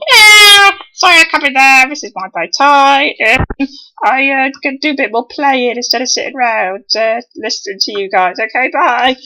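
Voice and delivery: high, loud put-on voice for the absent John; John's voice. Speaker voicing absent John's apology, high and loud. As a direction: voice John reading out his apology. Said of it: "So John can't come either today".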